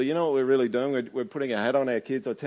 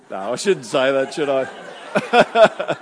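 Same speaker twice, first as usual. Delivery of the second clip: laughing self-correction; 7 dB louder, much slower than usual. Real, not acted. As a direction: giggle through it. Laughs at own joke.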